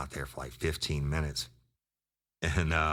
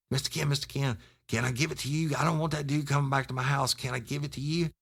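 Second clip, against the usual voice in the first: much higher, frantic mock pleading; panicked kid; 10 semitones higher, faster than usual. Scared informant pleading with the cop, high and frantic. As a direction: rattle it off like a scared tweaker.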